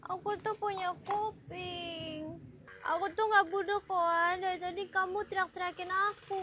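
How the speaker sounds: soft, slow complaint; gentle, hushed reproach; meek protest. Acted. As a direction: plead it quietly, drawn out. Second take, she is meek.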